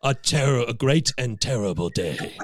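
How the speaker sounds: low, grave proclamation; governor quote. Retold or imitated. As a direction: intone it like a solemn governor. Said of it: "That's basically all he says".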